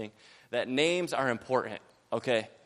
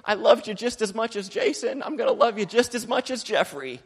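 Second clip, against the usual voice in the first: high, louder doting lady voice with a laugh; gushing lady, laughing; 8 semitones higher, 6 dB louder than usual. Retold or imitated. Lady consoling the misnamed preacher, high and laughing. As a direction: dote on him with a laugh. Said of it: "she's like".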